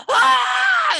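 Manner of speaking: agonized scream. Acted. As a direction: scream as salt burns the spirit away. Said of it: "and Wrath screams".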